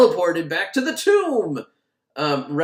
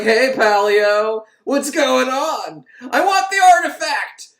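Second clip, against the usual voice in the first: high, loud and slow, building to a yell; demanding; 9 semitones higher, 7 dB louder, slower than usual. Retold or imitated. Heroine demanding the artifact from the Egyptologist, high loud yell. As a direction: barge in and demand it, louder as you go. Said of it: "She's like".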